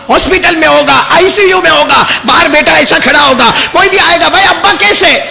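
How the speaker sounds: high, loud pleading sermon voice; fervent preaching. Acted. Preacher urging the children, loud and high. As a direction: press the love of fathers on them.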